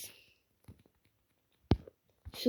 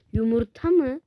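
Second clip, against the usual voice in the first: ordinary talk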